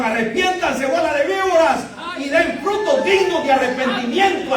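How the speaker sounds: John the Baptist thundering at the Pharisees, loud and high; Juan preaching. Retold or imitated. John the Baptist rebuking Pharisees in the desert, loud and high. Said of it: "entonces decía Juan".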